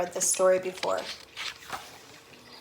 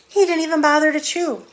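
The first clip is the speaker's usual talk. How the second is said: excited narration; 7 semitones higher, 9 dB louder than usual. A narrator's reading.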